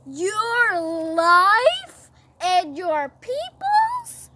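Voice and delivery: loud, very drawn-out question; outraged disbelief. Acted. King hearing his queen is threatened, incredulous. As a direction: repeat it in booming shock. Shocked king repeats Esther's words.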